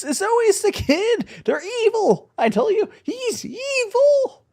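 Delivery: normal talking voice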